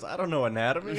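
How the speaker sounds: high, loud, laughing airhead voice; mock dumb waitress. Retelling the waitress's line, high laughing airhead voice. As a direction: play her clueless and laugh through it. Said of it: "She goes".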